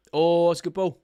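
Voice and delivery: sudden loud, excited outcry at a good pass; a fan jumping up at a great ball; excited burst. Real, not acted.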